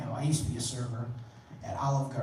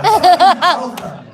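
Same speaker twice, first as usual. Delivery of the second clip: louder, slower, exasperated; louder, drawn-out It's Olive Garden; exasperated outburst; 11 semitones higher, 12 dB louder, slower than usual. Acted. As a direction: spell it out to the dumb customer. Exasperated at dumb customer.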